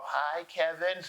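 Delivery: slow, high cartoon squeak of a starstruck kid; SpongeBob voice. Fan meeting tall Kevin, squeaking like SpongeBob. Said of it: "like SpongeBob".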